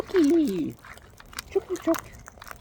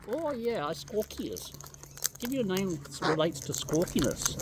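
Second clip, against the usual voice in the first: soft bird talk; 6 semitones higher, 7 dB quieter than usual. Real, not acted. Talking softly to bird at ear.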